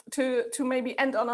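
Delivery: ordinary talk, nothing marked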